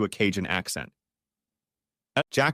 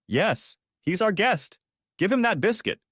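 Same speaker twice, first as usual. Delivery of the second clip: higher, firm order to her husband; wife bossing her husband, commanding and higher; 10 semitones higher than usual. Acted. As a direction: tell him to hand it over. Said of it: "she ordered her husband".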